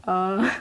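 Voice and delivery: "uh" as a lax neutral schwa; relaxed schwa. Shown as a demonstration. Linguist shows what schwa is, relaxed "uh". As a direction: say "uh" with the mouth fully relaxed. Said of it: "really relax your mouth".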